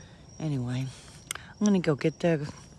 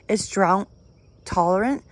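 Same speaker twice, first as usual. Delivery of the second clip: ordinary talk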